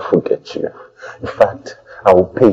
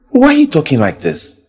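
louder, higher repeat of the question; pressing louder; 6 semitones higher, 5 dB louder than usual. Acted. Hurt lover pressing his girlfriend for an answer. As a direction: push the question harder.

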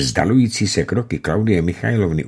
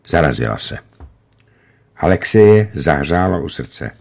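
ordinary delivery

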